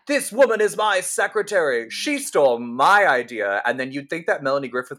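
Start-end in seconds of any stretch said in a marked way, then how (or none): 0.0-3.6 raised voice, accusing, slowing down on the last words; indignant accusation. Retold or imitated. Sigourney bursting into the boardroom, indignant raised voice. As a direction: storm in and point the finger. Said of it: "Sigourney bursts in".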